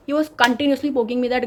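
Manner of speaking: usual speaking voice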